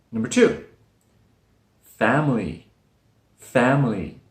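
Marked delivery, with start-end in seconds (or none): 1.8-4.2 "family" as fam-lee; two-syllable family. Shown as a demonstration. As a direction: drop the middle vowel of "family". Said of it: "this word has two syllables".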